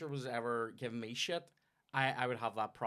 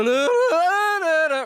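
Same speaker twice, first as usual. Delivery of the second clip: much louder, much higher jarring mock chords; out-of-tune chord imitation; 17 dB louder than usual. Shown as a demonstration. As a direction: blast out the sour chord. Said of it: "then it's gonna go".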